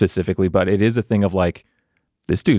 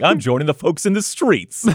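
higher-pitched imitation of a rallying cry; mock proclamation; 8 semitones higher than usual. Retelling his declaration to join the people, mock proclamation. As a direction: announce it grandly, lightly mocking. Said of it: "he said the rest of it out loud".

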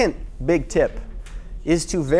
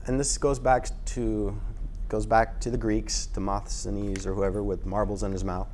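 ordinary delivery

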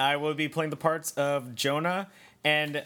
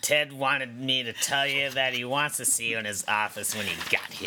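put-on witchy old woman; 6 semitones lower than usual. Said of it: "just gets more and more witchy".

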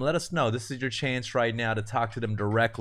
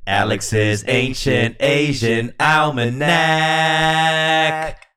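announcer voice; 4 semitones higher, 7 dB louder, much slower than usual. Acted. Closing the segment title.

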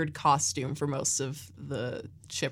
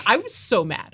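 normal talking voice

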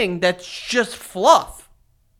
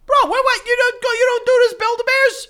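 high, loud disbelieving exclamations; incredulous outburst; 8 dB louder than usual. Real, not acted. Reacting to friend on how stuffing works, incredulous outburst. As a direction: blow up in shrill disbelief at your friend.

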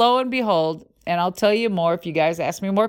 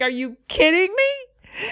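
stunned disbelief; 9 semitones higher, slower than usual. Retold or imitated. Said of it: "they're like".

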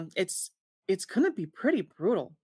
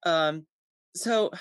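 ordinary talk

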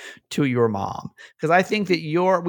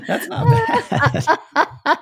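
laughing; much slower than usual. Real, not acted. Laughing at nineteen minute call.

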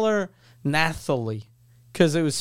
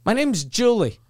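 higher, louder, fed-up rant; annoyed Julie; 6 semitones higher, 5 dB louder than usual. Retold or imitated. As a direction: vent at the weirdo, exasperated and laughing. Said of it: "And then she goes".

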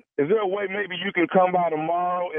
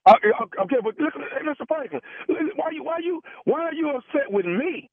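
high, pleading, defensive; flustered manager; 6 semitones higher than usual. Acted. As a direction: play the nervous manager begging off blame. Prankster plays flustered manager.

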